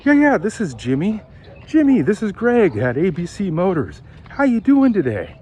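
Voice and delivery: high, upbeat phone pitch; happy and excited. Shown as a demonstration. Salesman Greg introducing himself on the phone, upbeat. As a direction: sound glad to reach him. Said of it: "Be a little excited, you know, be happy".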